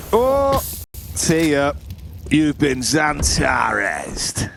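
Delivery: very slow, stretched-out kill call; hammed-up caster's drawl; drawn-out catchphrase. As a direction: savour every syllable of the send-off.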